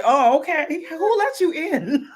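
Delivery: high slow put-on voice; playful squeaky reaction; mock surprised man.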